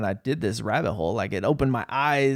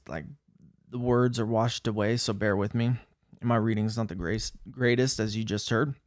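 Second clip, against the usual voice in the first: ordinary talk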